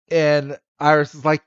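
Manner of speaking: normal talking voice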